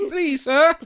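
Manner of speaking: high-pitched begging orphan; Oliver Twist. Retold or imitated. Hungry newcomers beg at the deli window, in a high mock-orphan voice. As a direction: put on a pleading little urchin voice. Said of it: "Oliver Twist".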